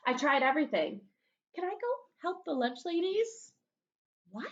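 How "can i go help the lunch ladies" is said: put-on pleading kid.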